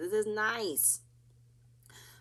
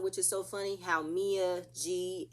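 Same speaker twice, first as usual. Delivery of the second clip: ordinary talk, nothing marked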